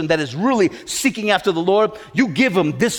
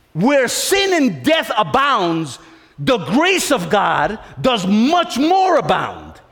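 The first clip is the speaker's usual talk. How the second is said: slow, deliberate verse recital; measured, solemn reading; slow proclamation; slower than usual. Real, not acted. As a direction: lay out each word slowly. Preacher quotes the verse slowly.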